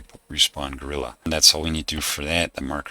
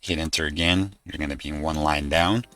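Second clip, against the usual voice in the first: dictating. Shown as a demonstration. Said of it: "and this is the code".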